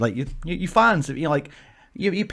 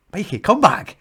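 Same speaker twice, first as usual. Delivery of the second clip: louder, higher urgent call; 6 semitones higher, 5 dB louder than usual. Retold or imitated. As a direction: call him back with rising urgency. Retelling a more urgent second try.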